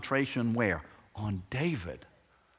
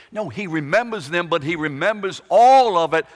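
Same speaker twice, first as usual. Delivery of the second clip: loud, driving sermon build; preaching at full force; 7 semitones higher, 11 dB louder than usual. Acted. Preacher piles up David's troubles, loud and building. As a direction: raise the voice and drive the list home.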